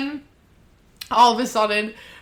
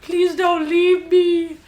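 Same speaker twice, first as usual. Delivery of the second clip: mock lovesick pleading; 8 semitones higher than usual. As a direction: plead like a lovesick cartoon sidekick, hammed-up. Mimics Trinity pleading across room.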